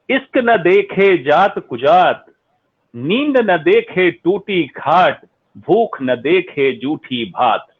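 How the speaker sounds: slow, measured recitation of a folk saying; reciting a couplet. Acted. Doctor recites a saying to the audience, slowly and rhythmically. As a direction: chant each line slowly like an old proverb.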